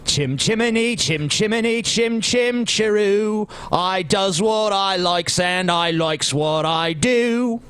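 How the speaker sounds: sing-song fake Cockney like a chimney sweep.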